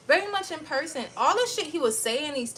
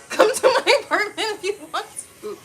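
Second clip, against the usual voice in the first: high, loud dare through laughter; laughing taunt; 8 semitones higher, 9 dB louder, faster than usual. Retold or imitated. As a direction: laugh off the threat and invite it. Taunting reply repeated, laughing.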